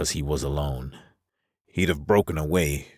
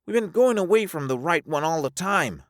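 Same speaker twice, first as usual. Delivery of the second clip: very high character voice; high-voiced Alvar, complaining. Alvar wanting to turn back, very high voice. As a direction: object in a high register.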